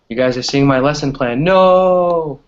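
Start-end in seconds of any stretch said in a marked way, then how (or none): 1.4-2.4 loud, high startled no. Real, not acted. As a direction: cry out in sudden dismay. Realises lesson plan is showing.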